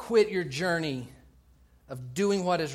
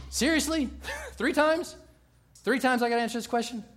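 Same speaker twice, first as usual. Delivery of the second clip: higher-pitched, incredulous acted protest; questioning, put-upon disbelief; a little irritated; 7 semitones higher, 4 dB louder than usual. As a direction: play Peter's mild exasperation at being asked. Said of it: "Peter was getting a little irritated".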